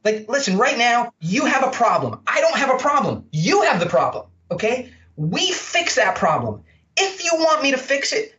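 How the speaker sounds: high, emphatic take-it-or-leave-it delivery; punchy, high-pitched straight talk; blunt sales pitch. Shown as a demonstration. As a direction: lay it out bluntly to a client, pitched well up. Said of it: "that's my voice. You gotta say it in your own voice".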